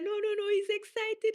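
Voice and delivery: high, amused quoted voice; lightly voiced pastor cheering her on; a hint of the excited pastor; quoting the pastor. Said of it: "He said".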